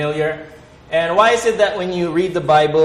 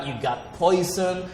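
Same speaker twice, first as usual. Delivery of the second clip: normal talking voice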